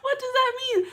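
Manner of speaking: high, laughing disbelief, loud; stunned, half laughing; shocked disbelief. Real, not acted. Reacting in disbelief to news.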